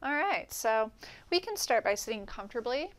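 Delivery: ordinary talk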